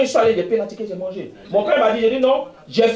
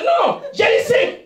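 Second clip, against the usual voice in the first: exclaimed shout; 13 semitones higher, slower than usual. Retold or imitated. Recounting failing the exam.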